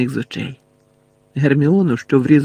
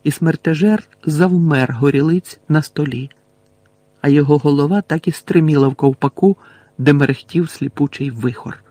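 plain reading voice

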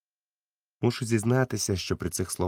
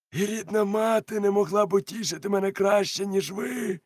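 much higher grateful exclaim; 13 semitones higher than usual. A narrator's reading. Silver exclaims to the doctor, much higher, relieved. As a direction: burst out in relief. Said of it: "вигукнув він".

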